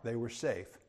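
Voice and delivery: ordinary delivery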